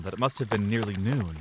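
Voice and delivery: usual speaking voice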